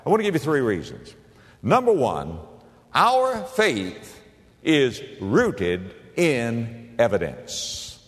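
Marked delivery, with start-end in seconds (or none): 2.9-8.0 very slow, word-by-word emphasis; emphatic declaration. Real, not acted. Preacher states first main point.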